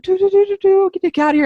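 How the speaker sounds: high frantic mutter; flustered high-pitched panic; panicked. Retold or imitated. Panicked retold fleeing.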